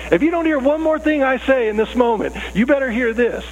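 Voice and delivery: high, emphatic lecture from a parent. Acted. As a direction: hammer home the stakes to the kids. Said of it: "I will stop emphasizing the point".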